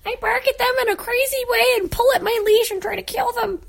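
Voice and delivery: high silly voice for the pet dog; dog's voice. Pretend. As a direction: speak for the dog, Ponyo. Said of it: "Ponyo, do, Ponyo".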